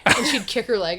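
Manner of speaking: normal talking voice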